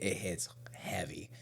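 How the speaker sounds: hushed, breathy whisper. Real, not acted. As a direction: drop to a whisper for effect. Stressing how heavy the breakdown hits.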